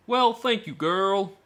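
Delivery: slow drawling put-on accent, lightly done. Reading the man's chat line to the girl in a mock accent. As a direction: stretch out thank you in a jokey accent. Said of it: "Sorry about this accent".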